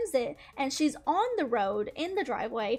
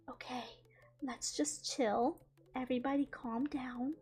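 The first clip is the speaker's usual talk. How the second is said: calming hush; 8 dB quieter than usual. Retold or imitated. Calming everyone after the crash.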